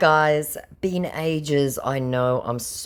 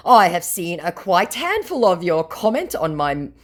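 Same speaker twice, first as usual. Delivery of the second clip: a higher, hammed-up read of his message; lightly mock his stiff English; mock scammer voice; 7 semitones higher, 3 dB louder than usual. Scammer explaining his private page, in lightly mocking higher voice. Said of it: "he says, plowing on regardless".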